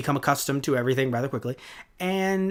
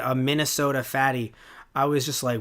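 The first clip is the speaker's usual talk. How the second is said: ordinary talk, nothing marked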